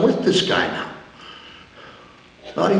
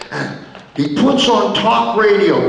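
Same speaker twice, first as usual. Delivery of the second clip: normal talking voice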